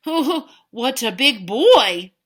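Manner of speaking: slow, loud giant voice; impressed giant. Acted. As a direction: marvel down at the huge baby. Giant peering into cradle.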